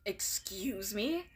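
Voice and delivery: soft, slow, voiceless retort; scowling whisper. Acted. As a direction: hiss back offended. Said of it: "River Tinge scowls".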